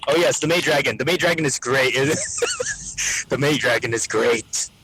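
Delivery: ordinary delivery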